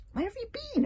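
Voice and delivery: high, put-upon dragon voice; squeaky irritated question; irritated Toothless. A narrator's reading. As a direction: snap it at him, a little cross. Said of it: "a little irritated".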